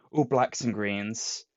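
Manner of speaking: normal talking voice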